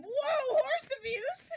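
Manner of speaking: high, pretend-shocked protest; mock alarm. Friend objecting to horses being hit, high mock alarm. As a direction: call it out high like a scandalised onlooker.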